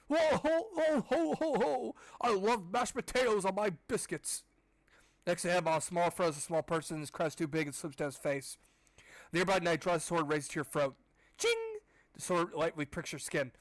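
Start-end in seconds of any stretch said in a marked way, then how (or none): 0.1-4.4 high booming ho ho laugh, slow; hammed-up merry king voice; jolly king. 11.4-11.8 high sharp metallic ching; vocal sword ring. Pretend. Sword sound.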